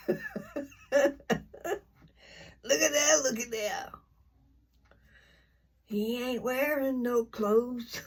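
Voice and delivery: "Look at that" tossed out high like a shocked onlooker from the song; quoting [name]. Retold or imitated. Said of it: "that song called [name]".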